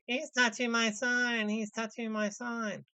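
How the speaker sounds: high, slow mocking imitation. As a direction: parody the complainer, drawn out. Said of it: "And then it's the".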